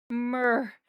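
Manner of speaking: short mimicked cat "murr"; imitated cat yell. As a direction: do a polite cat's sudden demand. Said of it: "but he'll go".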